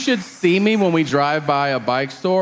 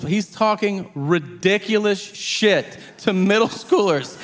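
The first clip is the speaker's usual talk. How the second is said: ordinary talk, nothing marked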